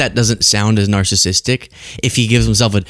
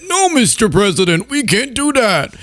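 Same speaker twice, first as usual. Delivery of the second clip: mock general; 8 semitones higher than usual. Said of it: "He'll say".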